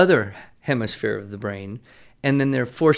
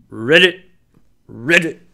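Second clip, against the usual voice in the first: bullfrog croak; 3 semitones higher, 8 dB louder than usual. Acted.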